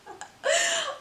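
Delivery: squeaky giggle through tears; high laugh. Real, not acted. Laughing at her dream story.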